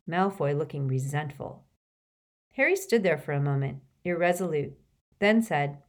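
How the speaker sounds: plain reading voice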